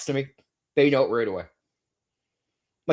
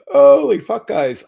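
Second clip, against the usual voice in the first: loud, high burst of disbelief; exasperated yell; 10 semitones higher, 10 dB louder, slower than usual. Real, not acted. Fed-up streamer erupts at his haters, exasperated yell. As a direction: blurt it out, fed up. Exasperated outburst mid rant.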